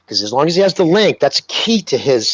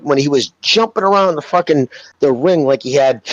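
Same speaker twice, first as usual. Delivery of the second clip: normal talking voice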